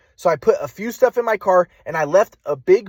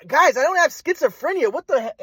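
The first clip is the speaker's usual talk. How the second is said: retold yell; 9 semitones higher than usual. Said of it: "I started to yell at them".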